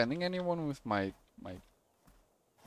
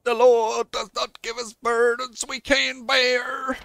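high, loud, slow proclamation; hammed-up pious clergyman; preaching priest; 10 semitones higher, 10 dB louder, slower than usual. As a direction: proclaim it like a pastor, stretching words.

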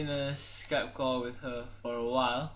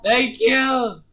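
excited thanks; 7 semitones higher, 10 dB louder than usual. Real, not acted. Thanks sponsor for money.

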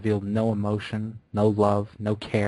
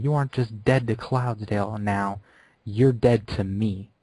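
angry rejection; 3 semitones higher than usual. Acted. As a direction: let the anger return and condemn. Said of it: "remembering her anger suddenly".

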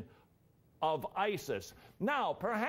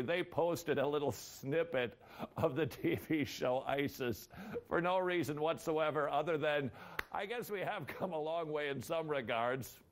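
usual speaking voice

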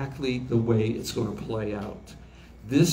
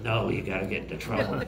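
a hint of a sly little devil, fast and softer; 3 semitones lower, 4 dB quieter, faster than usual. Shoulder devil urging the boy into trouble, lightly voiced quick and soft. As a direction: tempt him quickly, like the devil on his shoulder. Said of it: "And the little devil is going".

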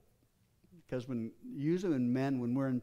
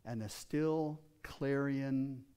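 usual speaking voice

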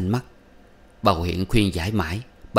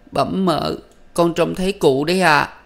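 sobbing; 10 semitones higher, 5 dB louder than usual. A narrator's reading. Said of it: "Con Sen nức nở".

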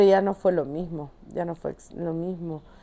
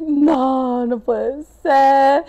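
louder, long drawn-out words; dismayed disbelief; 4 semitones higher, 4 dB louder, much slower than usual. Retold or imitated. Climber seeing the pyramids ahead, drawn-out dismayed disbelief. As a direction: stretch it out in comic disbelief.

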